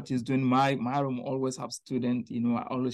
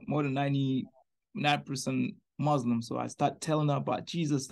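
ordinary delivery

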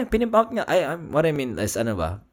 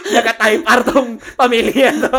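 laughing; 8 semitones higher, 8 dB louder than usual. Real, not acted. Laughing at joke.

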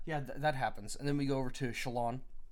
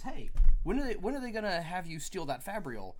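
loud, much higher "Hey", fading back to normal; mock call out; 5 semitones higher, 7 dB louder than usual. Yasna teasing Shallan about stealing, opening with a loud high hail. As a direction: call out to her as if across a room, then settle. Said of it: "Yasna says".

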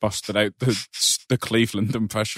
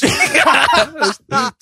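shrieking laugh; 7 dB louder than usual. Real, not acted. Laughing after impression.

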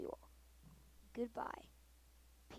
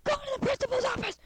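high, loud mock teacher shout; teacher yelling; 13 semitones higher, 9 dB louder than usual. Imitating the teacher scolding a first grader, mock shout. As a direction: bark it like a strict teacher scolding a kid. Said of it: "they would be like".

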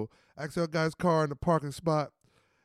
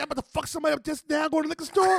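higher and fast, tumbling out; eager rush; 8 semitones higher, faster than usual. Retold or imitated. Gates to his boys, fast and higher. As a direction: rattle it off all fired up.

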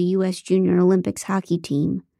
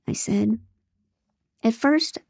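ordinary delivery

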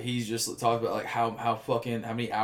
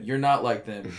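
normal talking voice

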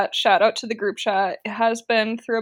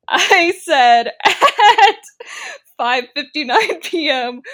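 laughing; 5 semitones higher, 9 dB louder, slower than usual. Real, not acted. Laughing while retelling.

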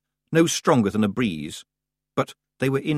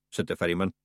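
usual speaking voice

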